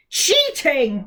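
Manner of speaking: loud angry roar. Acted. Giant mouth roars at the winners. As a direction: roar the accusation. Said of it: "roared the mouth".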